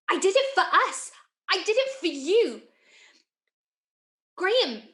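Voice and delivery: high-pitched, insistent plea; desperate pleading. Acted. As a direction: beg him to understand, voice rising. Pleads with horrified Graham.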